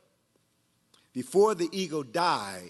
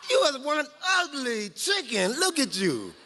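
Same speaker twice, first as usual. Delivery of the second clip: higher, taunting chicken voices; jeering chickens; 7 semitones higher than usual. A narrator's reading. Chickens mocking the baby eagles, high taunting voices. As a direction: sneer at the ugly newcomer. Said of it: "they said".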